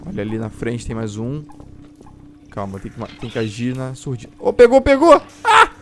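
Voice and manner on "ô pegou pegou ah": sudden high yell as something grabs; startled shout. Real, not acted. Gamer yelling as a monster grabs him, startled shout. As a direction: blurt it out, caught off guard.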